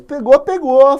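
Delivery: loud, high burst; mock excited shout. Teacher acting out the capture for the class. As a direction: blurt it out like the moment of capture.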